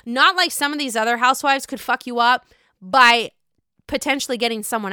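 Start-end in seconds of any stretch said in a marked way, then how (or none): none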